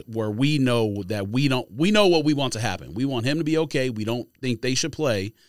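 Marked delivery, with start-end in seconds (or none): none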